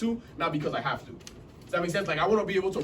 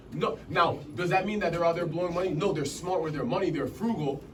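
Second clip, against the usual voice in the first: usual speaking voice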